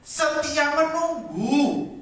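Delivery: slow, high, stretched out setia menunggu; drawn-out answer. Shown as a demonstration. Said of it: "Sabar itu artinya apa?".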